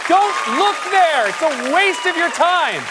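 high, slow, nervous fib; squeaky innocent act, badly hiding it; guilty driver voice. Driver lying to a cop.

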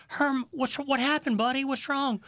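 put-on high, soothing voice for a scared boy; comforting a crying kid. Asking the sobbing boy what happened, soothingly. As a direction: coax him gently, like calming a child.

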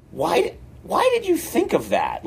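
hammed-up bewilderment, swooping in pitch; baffled questioning. Colleague reacting to the pitched sketch, mock baffled question.

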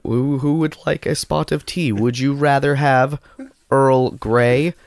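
mock English accent. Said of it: "This is my English accent".

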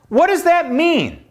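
high, incredulous question. Real, not acted. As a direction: ask it in high disbelief.

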